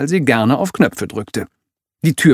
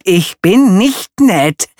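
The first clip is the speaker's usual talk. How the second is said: louder, higher, slow insistent denial; heated protest; 9 semitones higher, 7 dB louder, slower than usual. Acted. Villain insisting he is not nice, heated slow protest. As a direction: dig your heels in, indignant. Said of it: "ereiferte sich Alan".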